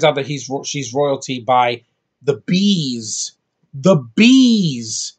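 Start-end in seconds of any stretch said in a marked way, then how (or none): 3.7-5.1 incredulous emphasis. Real, not acted. Mocking a stupid plot point.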